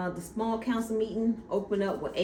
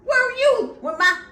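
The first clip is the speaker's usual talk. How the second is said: high, loud, raging demand; high-pitched loud outrage at the knight; furious shout; 12 semitones higher, 9 dB louder than usual. Retold or imitated. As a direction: yell it like a furious young king. Aegon voiced raging at Cole.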